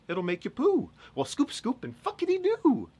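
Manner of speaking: very high, loud, silly climax; hammed-up squealed punchline; comic outburst. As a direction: shoot the pitch way up and belt it. Comic climax of mock advert.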